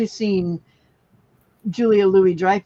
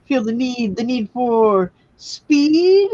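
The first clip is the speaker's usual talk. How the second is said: higher, slow, louder "need for speed"; movie line; 5 semitones higher, 5 dB louder, slower than usual. Pretend. Teasing the dog with a movie line, hammed up. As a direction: ham up the famous catchphrase.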